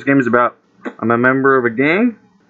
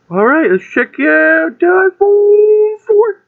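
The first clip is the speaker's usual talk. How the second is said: excited; 5 dB louder, slower than usual. Real, not acted. Hyped unboxing.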